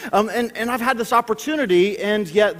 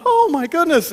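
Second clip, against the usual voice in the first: shocked gasp; 10 semitones higher, 5 dB louder than usual. Retold or imitated. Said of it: "I'm going".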